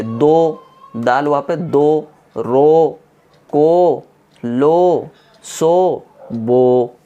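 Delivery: दो, रो, को with long, loud, held vowels; forceful drawn-out syllables. Shown as a demonstration. Teacher drilling students on long vowels, forceful and drawn out. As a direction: put force into दो, रो, को and stretch each one. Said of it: "थोड़ा सा इसमें ताकत लगा के खींच के पढ़ना है".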